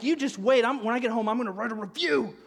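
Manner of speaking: loud, high mock threat; imitating an outraged customer storming off; dropping a bomb. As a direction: hurl a parting threat, hammed up. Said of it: "the guy drops that bomb".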